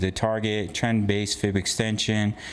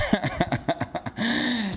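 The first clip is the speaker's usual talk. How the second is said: high laugh; 10 semitones higher than usual. Real, not acted. Laughs after rant.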